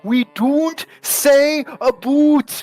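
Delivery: high, slow, drawn-out mock yell; tipsy coworker yelling at the table, high and drawn out; drunken yell. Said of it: "slaps the table and yells".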